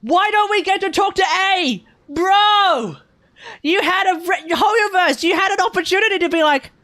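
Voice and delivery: high, loud, worked-up complaint; excited rant. Real, not acted. Streamer yelling at Hoyoverse in excited disbelief. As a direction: shout it out at the devs, exasperated. Ranting at the game studio.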